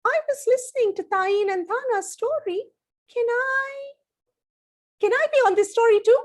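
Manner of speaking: high, eager little giraffe voice; a tiny excited animal begging to join; high childlike giraffe voice; baby giraffe voice. Acted.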